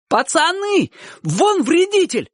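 put-on very high-pitched boy's cry; sudden shout. A narrator's reading. Someone alerting the boys to a saboteur, sudden high shout. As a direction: call out high, stretching the words. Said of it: "вдруг крикнул кто-то".

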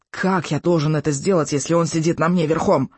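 strained, squashed croak; hoarse croak. A narrator's reading. Boy protests with bully sitting on him, strained croak. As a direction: croak out a protest under a heavy weight. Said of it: "прохрипел Икинг".

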